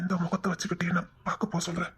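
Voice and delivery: ashamed whisper; barely voiced, hanging his head. Ashamed man avoids sister. (வெட்கத்தில் கிசுகிசுப்பு)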